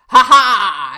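booming, drawn-out "ha-haaa"; big villainous storybook laugh, very loud; loud wolf laugh. A narrator's reading. Wolf mocks the fleeing piglets, laughing loudly. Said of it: "De wolf lachte luid".

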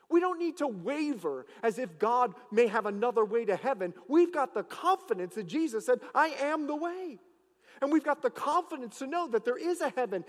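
loud, rising preacher's proclamation; full-force preaching. Acted. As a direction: preach it out to the whole church. Preaching to the congregation.

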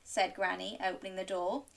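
usual speaking voice

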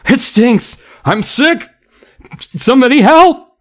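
high, loud crowd shouts; panicked cries. A narrator's reading. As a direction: cry out sickened and frantic. Panicked audience crying out.

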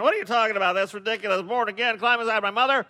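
high, loud, disbelieving protest; incredulous. Acted. Nicodemus loudly objecting to Jesus about being born again. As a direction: scoff at the absurd idea.

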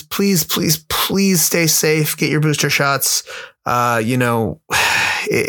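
ordinary talk

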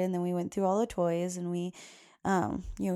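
ordinary talk

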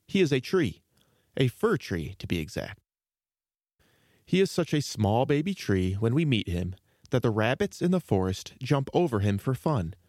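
ordinary delivery

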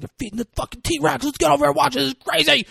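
put-on high, rushed, excited kid voice; stoked little kid. Little brother urging his brother to watch, stoked. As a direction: burst out like a thrilled little brother. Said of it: "little bro is, like, fucking sto".

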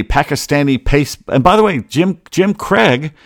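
ordinary talk, nothing marked

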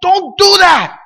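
loud, high, slow shout of "Don't"; parent's alarmed shout. Retold or imitated. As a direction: yell it like a father grabbing his son from the street. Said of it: "I'm gonna grab him and I say".

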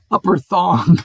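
breaking into laughter on Upper Thong; real giggle over the name, can't hold it; laughing. Real, not acted. Reading place names, cracks up laughing.

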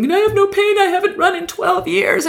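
high, breathless amazed cry; euphoric healed man. Retold or imitated. Healed man in the crowd crying out that the pain is gone, high and euphoric. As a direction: marvel at running again on pure adrenaline.

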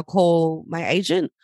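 normal talking voice

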